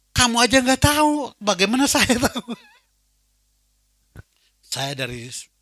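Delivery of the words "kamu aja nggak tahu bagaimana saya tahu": surprised retort. Retold or imitated. Retold phone call banter.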